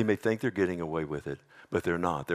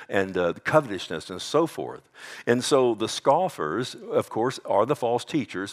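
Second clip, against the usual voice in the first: ordinary delivery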